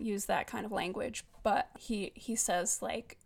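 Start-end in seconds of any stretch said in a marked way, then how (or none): none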